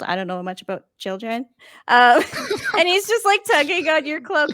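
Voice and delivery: ordinary talk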